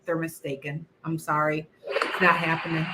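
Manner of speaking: normal talking voice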